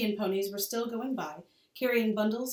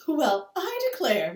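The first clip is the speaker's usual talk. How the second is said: loud, slow, higher amazed exclaim; exclamation; 7 semitones higher, 8 dB louder, slower than usual. Acted. Ma exclaims at Laura's tantrum, amazed. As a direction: marvel out loud. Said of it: "Ma exclaimed".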